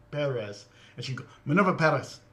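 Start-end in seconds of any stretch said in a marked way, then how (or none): 1.4-2.2 imitating a Spanish-accented name, rolled r. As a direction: lightly mimic the newscaster's Spanish accent on the name. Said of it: "crack out the the accent for her name".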